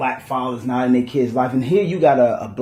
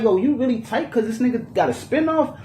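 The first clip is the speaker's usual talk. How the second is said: incredulous; 9 semitones higher than usual. Retold or imitated. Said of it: "I was like".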